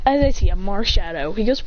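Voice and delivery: usual speaking voice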